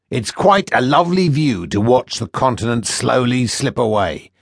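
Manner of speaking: mock the captive boy like a smug slaver; sneering first mate. First mate taunting the boy he sold, sneering.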